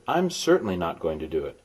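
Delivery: normal talking voice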